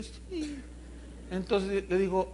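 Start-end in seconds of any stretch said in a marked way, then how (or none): 0.0-0.6 put-on high, very soft little girl's yes; tiny timid child voice, imitated; niña tímida. Niña tímida contesta al pastor. (vocecita aguda de niña tímida)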